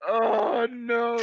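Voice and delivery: high, loud wailing "Oh, no"; hammed-up wail; mock dismay. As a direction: cry out in fake despair. Dramatic reaction.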